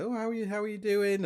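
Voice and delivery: mock high sing-song old lady; chirpy neighbour greeting. Mimicking an older neighbour's high chirpy hello. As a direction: lightly send up a chatty neighbour.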